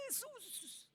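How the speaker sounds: un susurro casi mudo, lejano, la voz no sale; voz ahogada de miedo. Retold or imitated. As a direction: llamar a Jesús sin que salga el grito. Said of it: "yo me lo imagino diciendo". (susurro ahogado de pánico, mucho más suave)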